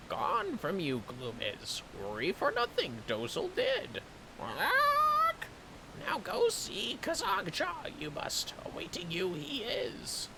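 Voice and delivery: high squawky bird voice, yoda-like word order; cartoon bird voice. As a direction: chirp it out like a silly little bird. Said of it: "I have to do the bird voice again".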